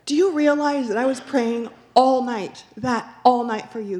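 high, loud, rushing with amazement; retold excited outburst to the woman; excited disbelief. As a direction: tell her you prayed all night, thrilled. Retelling her excited words to the woman.

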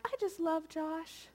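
imitating her own happy devotion; light, sweet retelling of loving days; doting wife. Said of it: "there are days when I'm like".

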